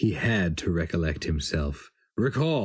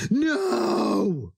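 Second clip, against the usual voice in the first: much higher, cutting cry that interrupts; cried out. A narrator's reading. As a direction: break in with a sudden shrill no. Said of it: "Sheo Narnra cried".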